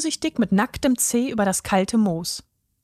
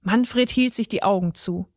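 ordinary delivery

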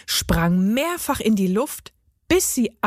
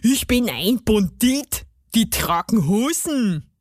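blustering dwarf in dialect; put-on gnome indignation; offended garden gnome. A narrator's reading. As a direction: insist like a proud little bandit.

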